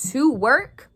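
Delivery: a sudden burst of fury; loud outburst. Real, not acted. Furious at corporate managers, suddenly loud outburst.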